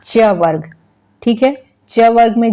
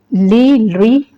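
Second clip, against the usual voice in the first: normal talking voice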